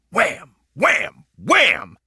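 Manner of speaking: loud rising shouted wham; three excited shouted whams; jousting sound effect. A narrator's reading. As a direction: bash out each wham like a lance strike.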